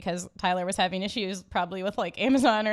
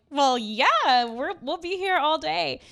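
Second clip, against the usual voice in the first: casual eager reply; 5 semitones higher, much slower than usual. Retold or imitated. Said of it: "I was like".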